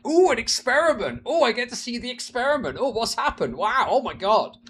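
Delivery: high, gushing ooh over a new experiment; mock excited nerd. Mocking an excited economist, high and gushing. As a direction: gush like a thrilled nerd at a new toy. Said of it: "He posted excitedly about it".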